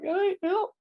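tiny, very high mock cry for help; sad plea. Retelling the hurt dog's call for help, pitiful high voice. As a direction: squeak out a pitiful plea, imitating. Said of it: "I thought that was sad 'cause she's like".

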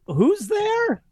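put-on high, wary old woman at the door; elderly restaurant owner calling who's there; Mama Tacconi. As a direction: call out through the door, suspicious.

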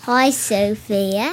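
put-on Accent Girl accent. Accent Girl says hi to young listener Sophia, put-on accent. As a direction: greet her in Accent Girl's drawn-out accent. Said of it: "No, Accent Girl".

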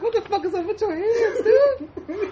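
high, cracking with laughter; can barely get it out for laughing; laughing disbelief. Pretend. Picturing his reaction to tiny hands, laughing.

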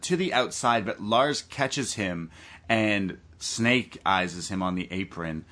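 ordinary talk

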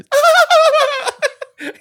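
loud, high mock cackle; imitated laugh. Imitating Gary laughing at the sexy talk, loud cackle. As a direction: burst into an exaggerated high laugh. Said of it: "And he laughed".

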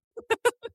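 a quick real laugh, pitched high; a burst of real laughter; short high laugh. Real, not acted. Sex therapist laughing at her own point. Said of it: "I'm laughing".

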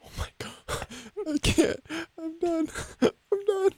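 high, squeaky laugh breaking through the words; crying with laughter, can't go on; real laughter, squeaked out high; helpless laughter. Real, not acted. Cracking up at caller's bit.

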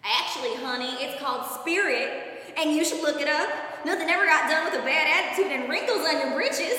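loud hammed-up Southern sass; southern accent. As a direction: lay on a sassy Southern accent like a pageant mom. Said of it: "me with a southern accent".

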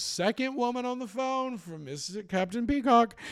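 high, loud sing-out impression of a shop colleague; Mister Humphreys. Colleague announcing a phone call for the captain, high mock call-out. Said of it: "Mister Humphreys goes".

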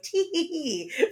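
mock high-pitched titter; slow, high, put-on little giggle; little tee-hee giggle. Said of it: "it literally is supposed to be, like, your little".

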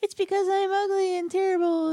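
high-pitched mock wail, hammed up; mock crying bride. Retelling the bride crying to her captain, mock high wail. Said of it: "she's crying 'cause she's like".

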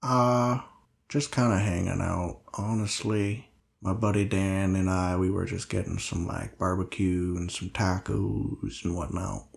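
low, quiet, halting role voice; subdued Linus, weary and hesitant. Acted. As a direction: speak low and softly, with hesitant pauses. Game master voices Linus.

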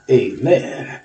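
usual speaking voice

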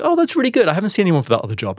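very high, delighted retold reply; imitated bubbly approval; pleased interviewer. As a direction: gush with relief, pitch leaping up.

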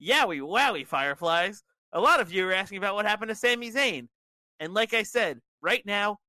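high, loud, chipper show host; chipper facade. A narrator's reading. Bray Wyatt addressing his Fireflies about Sami, chipper. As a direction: keep up a cheery front though upset. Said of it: "keeps a chipper facade anyway and says".

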